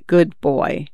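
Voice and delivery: lightly voiced stern dad. A narrator's reading. Son turning his father's words on him, understated dad voice. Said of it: "using his dad voice".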